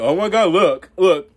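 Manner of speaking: high, loud mock-outraged newscaster; hammed-up media hysteria. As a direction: parody a panicky pundit clutching pearls. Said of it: "they be like".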